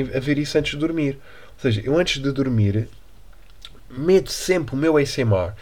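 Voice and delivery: normal talking voice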